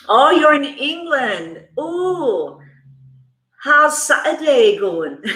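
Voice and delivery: drawn-out, badly faked English accent; terrible British accent. Reader greeting a viewer in England in a bad mock British accent. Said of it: "British accent is damn terrible".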